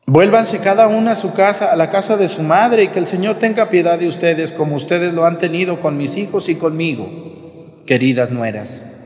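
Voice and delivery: projected scripture reading, gradually softening; reading Naomi's plea aloud. Acted. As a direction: read it out emphatically, settling at the end. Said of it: "Vamos a leer esto textualmente".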